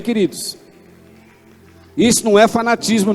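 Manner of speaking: ordinary delivery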